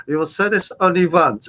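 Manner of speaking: put-on clipped comedy German accent, lightly done; playful put-on German accent; mock German accent. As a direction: imitate a TV-sitcom German officer accent. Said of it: "decided to speak in a German accent".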